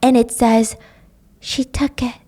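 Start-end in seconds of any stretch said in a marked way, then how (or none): 1.4-2.2 soft, eerie voice in her head; ghostly whisper. Acted. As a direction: breathe it like a far-off spirit. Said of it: "a whisper that sounds like her voice".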